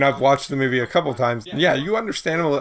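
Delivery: ordinary talk, nothing marked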